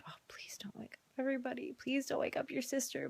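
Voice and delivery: soft, whispered begging; pleading with the toddler not to wake the house. Retold or imitated. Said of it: "I'm like".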